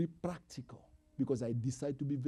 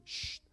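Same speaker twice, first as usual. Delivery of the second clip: shushing; 8 dB quieter than usual. Shown as a demonstration. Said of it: "You say".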